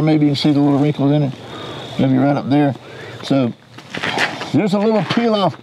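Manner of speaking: muffled behind mask; speaking inside respirator; through a gas mask. Real, not acted. Seen 2: Full-face gas mask worn throughout the labelled words; unmasked in R frames.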